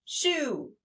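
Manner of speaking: "Shoe" high and falling, one beat; high-pitched teacher demo of "Shoe"; single syllable demo. Shown as a demonstration. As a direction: swoop down through a single "Shoe". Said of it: "one syllable in shoe".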